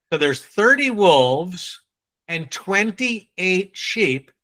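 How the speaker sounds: slow, careful, word by word; meticulous diction. Shown as a demonstration. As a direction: spell out each number slowly so nobody mishears. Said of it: "the whole point of the exercise".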